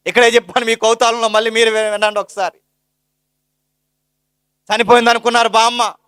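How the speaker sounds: sermon at full force; fervent preaching. Acted. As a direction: preach it out to the congregation.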